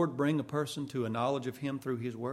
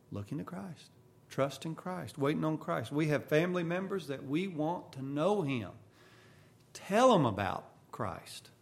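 ordinary delivery

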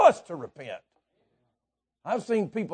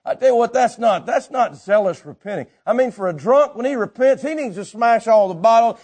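raised, insistent preacher voice; forceful preaching; 7 semitones higher, 6 dB louder than usual. Real, not acted. Preacher telling people how a drunk should repent, forceful preaching. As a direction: drive the point home from the pulpit.

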